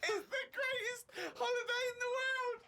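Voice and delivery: very high, squealing through laughter; helpless laughter. Real, not acted. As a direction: wheeze it out while cracking up.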